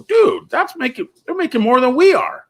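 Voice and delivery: high, indignant mock complaint, slowed down; imitating outraged coworker. Detail guys complain about their pay, high and indignant. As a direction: act out the detail guys' outrage, pitched well up. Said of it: "And they go".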